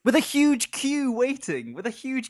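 high, loud outraged exclaim; indignant. Real, not acted. Indignant about the phone call.

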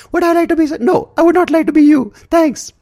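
high, loud sarcastic comeback; mocking retort. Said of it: "And you're like".

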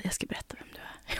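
soft whisper, barely voiced; imitated whisper. Boyfriend whispering a jab in the kitchen, soft whisper. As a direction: hiss it quietly like a secret. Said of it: "Han bara".

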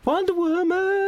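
mock fanfare Wonder Woman; excited exclamation. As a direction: Wonder Woman sung out very high and slow, like a superhero theme. Echoes the film title excitedly.

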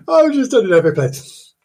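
high, loud giggling through the words; a real squeal of amusement; squealing laugh. Real, not acted. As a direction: laugh at your own blunder. Laughing at own slip.

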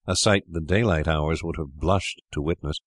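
ordinary delivery, nothing marked

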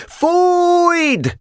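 very high, ringing command; shouted order. A narrator's reading. Boka signalling the trumpet to sound, shouted order. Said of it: "kiáltotta Boka".

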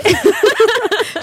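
high giggling burst; high burst of laughter. Real, not acted. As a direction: break into laughter. Loses focus, bursts out laughing.

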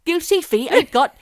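loud, high West Country burr. Acted. Host sending up Careless Whisper as a farmer, hammy West Country. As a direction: belt it out in a broad West Country accent. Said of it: "Eddie Grundy doing Careless Whisper".

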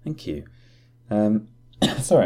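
coughing after a sip; choked up on water, coughing; choking on a drink. Real, not acted. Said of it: "I'm just choking here".

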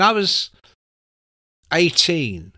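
ordinary talk, nothing marked

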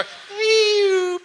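high electronic squeal imitated; high squealing feedback noise; megaphone squeal. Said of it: "megaphone go".

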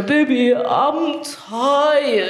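put-on drawn-out, babyish whine on tired; baby talk. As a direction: say it like a tired toddler to a partner. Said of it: "Baby talk".

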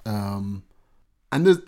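normal talking voice

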